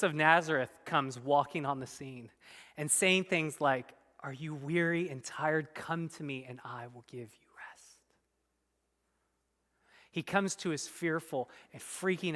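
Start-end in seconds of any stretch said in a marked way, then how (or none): 4.2-8.0 soft, dropping to a whisper; a gentle hushed call to come; whispered invitation. Acted. Preacher voicing Jesus to the weary, whispering.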